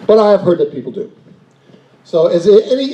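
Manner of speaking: normal talking voice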